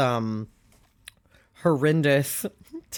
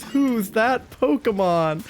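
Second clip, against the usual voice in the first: announcer voice; 7 semitones higher, slower than usual. Retold or imitated. Quoting the show's catchphrase.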